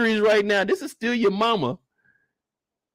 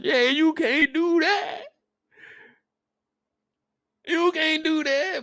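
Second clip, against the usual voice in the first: mock pleading; 9 semitones higher than usual.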